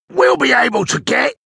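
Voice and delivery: high, effortful character voice; labouring crook, pitched well up; older thief at work. A narrator's reading. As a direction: talk in bursts between pickaxe blows. Older thief talks between pickaxe swings.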